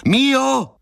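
high, drawn-out cry for someone far off; desperate high-pitched holler; shouted call. A narrator's reading. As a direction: call the name out high and long, shouting into the dark. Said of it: "vykřikl jsem".

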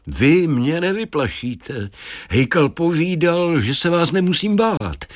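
calm talking deer. A narrator's reading. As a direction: calmly reassure them as the stag. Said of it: "prohlásil klidně jelen". (klidný hlas mluvícího jelena)

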